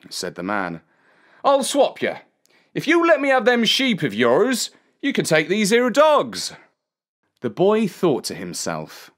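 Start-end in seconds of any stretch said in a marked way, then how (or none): 1.4-6.5 higher, rustic character voice; bargaining dog owner. A narrator's reading. Man offers boy dogs for sheep, eager bargaining. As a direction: pitch the deal in a high trader's voice.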